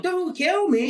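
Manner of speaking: high-pitched mock plea; mock yelling. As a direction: yell it pleadingly from the cross. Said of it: "fucking up there yelling".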